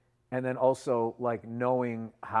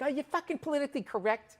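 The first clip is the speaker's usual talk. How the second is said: high-pitched indignant gripe; mock indignant comic. Comic griping at a groaning crowd, mock indignant. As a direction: complain in a much higher voice.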